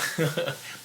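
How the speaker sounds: short, soft, high chuckle; mock laughter. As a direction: pretend to laugh at their pain. Acts out laughing at the reaction.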